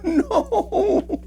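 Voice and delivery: high squeaky stunned no; shocked disbelief. Real, not acted. Cohost hearing giraffe died, shocked squeak. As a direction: react in sudden disbelief. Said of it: "reacting to dead giraffe".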